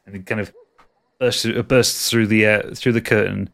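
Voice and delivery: usual speaking voice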